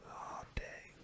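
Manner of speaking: usual speaking voice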